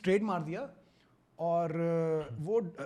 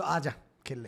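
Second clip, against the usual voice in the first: mock invitation; 6 semitones lower, 5 dB quieter than usual. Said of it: "फिर बोलो".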